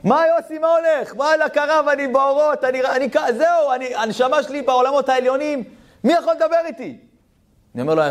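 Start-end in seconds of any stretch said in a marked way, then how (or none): 0.0-7.0 ecstatic rapture. Retold or imitated. Yossi in ecstasy on phone.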